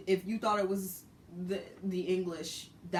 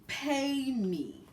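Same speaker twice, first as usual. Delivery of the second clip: high, slow, drawn-out mock demand; high slow mocking driver voice; 7 semitones higher, 4 dB louder, much slower than usual. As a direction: stretch it out like a stubborn cabbie. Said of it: "So this man's like".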